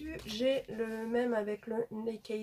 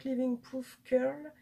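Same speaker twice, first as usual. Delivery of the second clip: English brand name in a French accent; slightly slow English with a French accent; 3 semitones higher, 4 dB louder, slower than usual. Real, not acted. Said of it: "désolée pour mon accent pourri".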